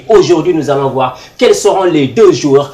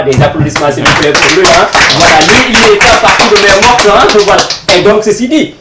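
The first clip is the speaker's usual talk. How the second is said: hyped host call; 5 semitones higher, 7 dB louder than usual. Real, not acted. Host hypes crowd for applause.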